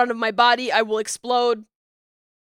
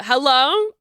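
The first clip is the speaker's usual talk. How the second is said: ordinary delivery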